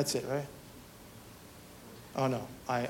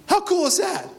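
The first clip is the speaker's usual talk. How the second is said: higher, bright burst on "How cool is that"; excited; 4 semitones higher, faster than usual. Real, not acted. Pastor to the Wednesday crowd, openly excited. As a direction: let the excitement jump out. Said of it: "you're not supposed to get excited".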